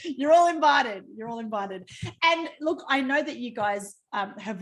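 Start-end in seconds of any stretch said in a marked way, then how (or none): 0.0-1.0 loud, higher, excited call; called-out invitation. Real, not acted. Host inviting everyone on the call to the wedding, excited shout. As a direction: call it out to the whole group.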